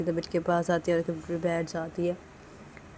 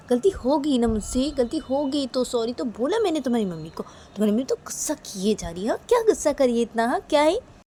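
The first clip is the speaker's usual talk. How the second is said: raised, higher-pitched grumbling; exasperated complaining; 7 semitones higher, 8 dB louder than usual. Acted. Nisha complaining to baby about its angry mummy, exasperated. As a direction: grumble loudly, building in volume and pitch.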